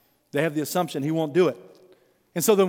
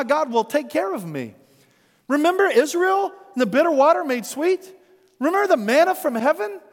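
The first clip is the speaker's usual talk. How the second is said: higher, incredulous rebuke with a laugh at the start; exasperated Jesus; 7 semitones higher than usual. Acted. Jesus rebukes his hungry, worried disciples, incredulous and raised.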